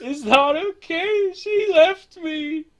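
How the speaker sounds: high, slow, weepy wail; sobbing man. Acted. Heartbroken stranger wailing to the player about his lost love. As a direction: blubber it out through tears, way up high.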